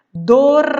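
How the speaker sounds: Dormia with the R before the m marked and loud; R sound shown. Shown as a demonstration. Teacher to pupils, marking the R in Dormia. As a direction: stress the R in Dormia. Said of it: "É o R também".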